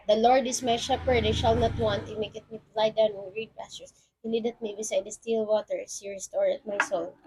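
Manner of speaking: "The Lord is my shepherd" rattled off quickly with no pauses; rushed memorized recitation. Shown as a demonstration. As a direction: race through "He maketh me to lie down in green pastures" as if reciting from memory. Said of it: "You're just simply memorizing the lines".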